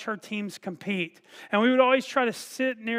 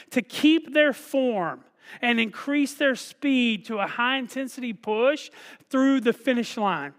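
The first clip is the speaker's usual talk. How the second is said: ordinary talk, nothing marked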